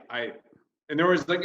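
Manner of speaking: ordinary delivery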